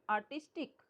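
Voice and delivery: ordinary delivery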